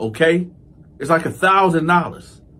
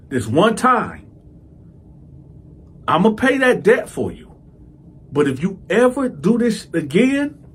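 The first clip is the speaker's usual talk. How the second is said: stern warning; 5 semitones higher than usual. Retold or imitated. Retold threat to Lil J.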